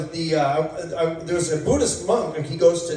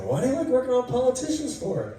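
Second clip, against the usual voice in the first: high mock doctor voice; 9 semitones higher than usual.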